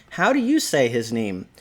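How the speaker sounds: usual speaking voice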